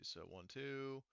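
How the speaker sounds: normal talking voice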